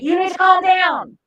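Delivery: loud, high-pitched order to someone pinned; yelled command. Acted. Cop to someone face down on concrete, yelled command. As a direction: shout it sharply, high and loud.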